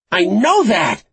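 high, loud, frustrated shout. A narrator's reading. Frankie answers the captor's email out the window, shouting. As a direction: shout it out into the street. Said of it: "she shouted out the window".

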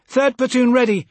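high, loud radio report; military report. A narrator's reading. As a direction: call it in to the commander. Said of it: "she reported".

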